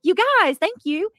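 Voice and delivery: excited. Real, not acted. Flood of gifted memberships.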